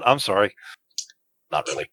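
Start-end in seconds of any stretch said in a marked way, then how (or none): 1.5-1.9 muttered under breath, soft; whispered aside. Real, not acted. Muttered aside after apology.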